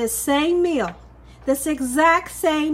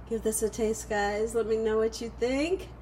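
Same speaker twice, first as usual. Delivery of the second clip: usual speaking voice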